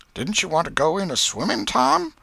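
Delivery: aunt slyly questioning nephew Tom about school; Aunt Polly. A narrator's reading. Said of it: "Said she".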